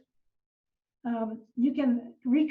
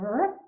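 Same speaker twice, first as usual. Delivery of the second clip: short "rup" sliding sharply upward; quick upward whoop; imitated rising chirp. Shown as a demonstration. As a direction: imitate a sound climbing in frequency. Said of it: "is the change in frequency".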